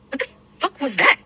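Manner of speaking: mother retelling her shock after the ambulance drives off; shocked cry. Retold or imitated. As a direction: blurt it out as if still stunned. Ambulance speeds off, retold shock.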